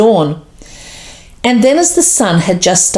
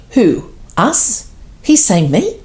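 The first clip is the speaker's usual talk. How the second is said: ordinary delivery, nothing marked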